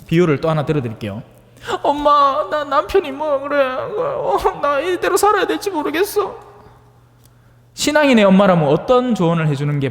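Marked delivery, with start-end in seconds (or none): 1.8-6.4 high, plaintive daughter's complaint with a laugh; whiny daughter. Retold or imitated. Daughter whining to her mother about her husband, high and plaintive. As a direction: complain to mum like a fed-up daughter.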